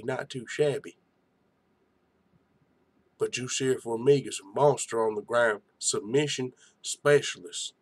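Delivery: ordinary talk, nothing marked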